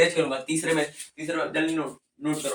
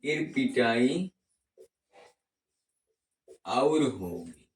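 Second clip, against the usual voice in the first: slow and drawn out, lower; 3 semitones lower, 4 dB quieter, much slower than usual. Shown as a demonstration. Teacher slows the mnemonic for note-taking, slow and drawn out. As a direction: stretch each word for dictation. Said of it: "ये थोड़ा fast है".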